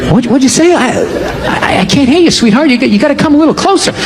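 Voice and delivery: put-on high-pitched talking-to-a-child voice; coaxing a child. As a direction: gently coax a little girl closer. Coaxing a small child in dream.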